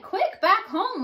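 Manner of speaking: high, hurried alarm; a touch of high panic; urgent. Acted. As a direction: rush them home urgently. Monsters hurry before daylight.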